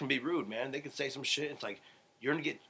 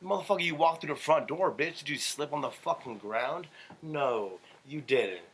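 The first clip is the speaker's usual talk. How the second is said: angry rant; 3 semitones higher than usual. Real, not acted. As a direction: tear into him for a dumb question. Ranting at cousin over salt.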